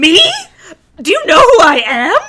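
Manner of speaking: loud, hammed-up indignation; mock offended disbelief. Kylo reacting to her rejection, mock offended outburst. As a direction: puff up with play-acted outrage.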